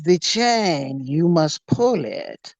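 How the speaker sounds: put-on slow, deliberate German accent. German stranger instructing a young man, slow German accent. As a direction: intone it as a creepy German stranger. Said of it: "German accent".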